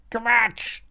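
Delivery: high parrot squawk; curt high-pitched bird croak. A narrator's reading. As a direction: squawk it dismissively. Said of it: "krächzt der Papagei".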